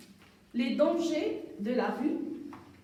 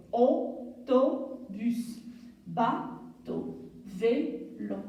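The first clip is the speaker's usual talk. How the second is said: Autobus said very slowly, syllable by syllable; slow syllable demo; much slower than usual. Shown as a demonstration. Teacher models Autobus slowly to pupils. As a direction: spell autobus out slowly in sound.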